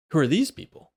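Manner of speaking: mild surprise from a tabaxi man; surprised. Acted. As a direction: caught off guard by strangers. Tabaxi man sees strangers.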